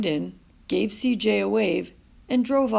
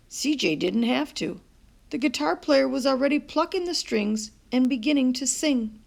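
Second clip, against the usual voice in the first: plain reading voice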